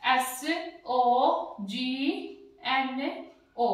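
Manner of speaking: very slow letter names; spelled out slowly. Shown as a demonstration. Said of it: "मैंने यहाँ पे लिखा". (धीरे धीरे अक्षर बोलना)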